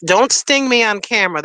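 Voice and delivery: usual speaking voice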